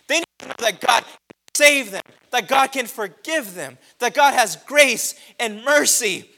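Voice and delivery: loud, high, tearful plea; impassioned preaching. Acted. Son pleading with his mother at the table, loud and high. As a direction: cry it out to mom and church alike. Retold plea at full force.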